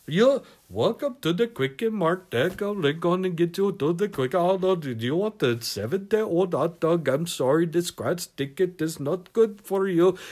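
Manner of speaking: convenience-store clerk's mock Indian accent; Apu. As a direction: put on a cartoon Indian accent. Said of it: "sound like a poo".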